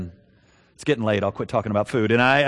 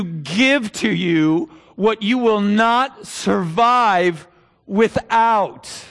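slow, rising, louder preacher build; preaching; 6 semitones higher, 5 dB louder, much slower than usual. Acted. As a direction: build from steady to full-force preaching. Sermon climax, building preach.

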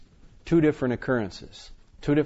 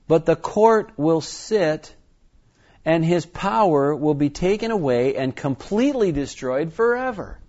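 emphatic proclamation, rising high at the close; scripture reading; 4 semitones higher, 4 dB louder than usual. Acted. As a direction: proclaim the verdict with force.

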